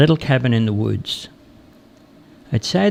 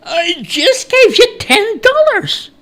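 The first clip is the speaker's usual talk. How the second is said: high, loud, slow outrage; indignant protest; 5 dB louder, slower than usual. A narrator's reading. Stranger protesting to the woodcutter who took his money, indignant.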